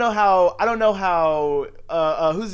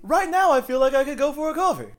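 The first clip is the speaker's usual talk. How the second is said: playful pitch voice; 10 semitones higher than usual. Pretend. Joining the Seinfeld pitch bit.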